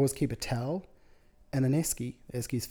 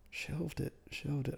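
softly turning the words over; quiet pondering to oneself; puzzled musing; 3 semitones higher, 9 dB quieter, slower than usual. Retold or imitated.

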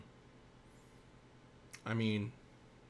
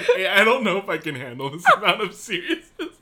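laughing mock panic; 10 semitones higher, 12 dB louder than usual. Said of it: "I was like".

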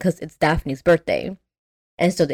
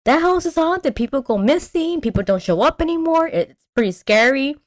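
high-pitched imitation of townsfolk; warning locals; 8 semitones higher than usual. Podcaster voices locals warning of haunted house, high-pitched. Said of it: "They meet all these people".

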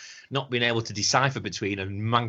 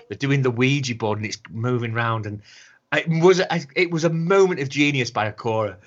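usual speaking voice

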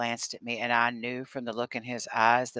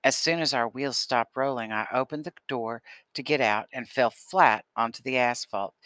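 ordinary delivery

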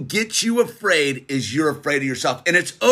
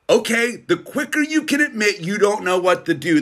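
normal talking voice

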